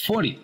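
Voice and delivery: "forty" as fordy, stress on first syllable; dropped T. Shown as a demonstration. Teacher models forty without the T. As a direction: soften the T in "forty". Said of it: "También quitamos ese sonido de T".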